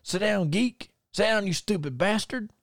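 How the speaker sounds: high-pitched mock heckler putdown; high retold country put-down; Bunkhouse Buck. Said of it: "the way he said it was so funny".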